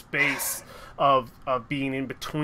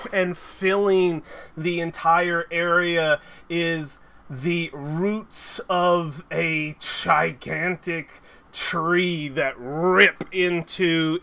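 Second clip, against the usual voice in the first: slow, building epic description; dramatic narration; 3 semitones higher, 4 dB louder, slower than usual. A narrator's reading. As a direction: narrate the entrance grandly.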